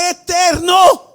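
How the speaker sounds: high loud eterno, cue to repeat; congregation call. Shown as a demonstration. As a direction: shout eterno for them to echo. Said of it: "Diga la palabra".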